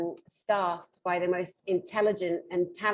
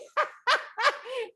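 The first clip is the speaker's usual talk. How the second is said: high-pitched burst of laughter; laughing. Real, not acted. Laughing at her own nail salon story, high giggling. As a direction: crack up at your own impression.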